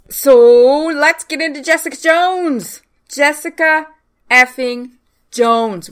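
high, loud, excited announcement; hyped introduction. Real, not acted. Podcaster launching into Jessica Jones, hyped and loud. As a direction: announce the title with big relish, word by word.

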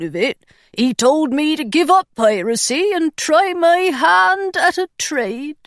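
slow, thin, anxious pirate voice; a timid pirate introducing himself. Acted. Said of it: "His voice was thin and anxious".

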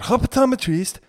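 loud hammed-up Chinese accent; mock Chinese accent. Comedian quoting his own bit, mock Chinese accent. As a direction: caricature Chinese accent on "optometrist". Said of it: "me saying it like that".

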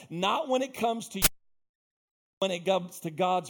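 high, driving, insistent sermon delivery; fired-up preaching. Real, not acted. Preacher urging a whatever-it-takes mentality, fired-up preaching. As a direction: pile up each vow with rising fervour. Preacher builds list of vows.

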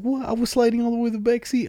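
put-on high-pitched amazed kid voice; mock excitement. Imitating Danny after the sharp turn, high excitement. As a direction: imitate a thrilled passenger, voice way up. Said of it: "it's 'cause he was excited".

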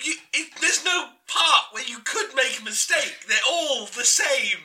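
high, insistent scolding; exasperated. Acted. Exasperated producer scolding George, high and insistent. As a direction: spell it out to a fool.

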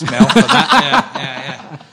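laughing. Real, not acted. Thanking Mel while laughing.

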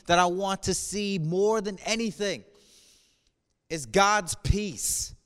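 ordinary talk

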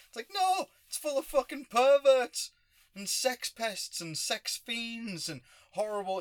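sudden high, loud outburst; loud rant. Real, not acted. Ranting against Hollywood.

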